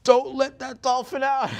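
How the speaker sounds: very high, loud comic dolphin squeal; piercingly high, put-on dolphin voice; dolphin imitation. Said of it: "really scary dolphin imitation".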